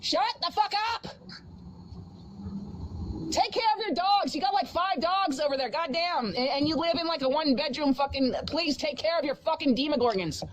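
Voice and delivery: high, angry yelling; yelling at the dog. Real, not acted. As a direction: shout across at the dog. Yelling at barking neighbor dog.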